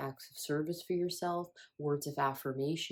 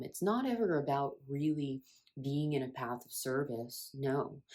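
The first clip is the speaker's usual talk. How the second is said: ordinary talk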